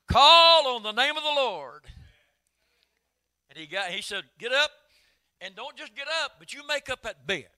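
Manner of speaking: loud, high ringing preacher's cry; preaching at full force. Acted. Preacher crying out the healing of Aeneas, loud and high. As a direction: shout the healing word to the church.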